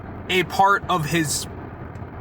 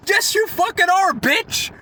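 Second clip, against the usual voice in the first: high-pitched, indignant outburst; exasperated retort; 11 semitones higher, slower than usual. Retold or imitated. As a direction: fire back at her in outraged disbelief. Angry retort to screaming customer.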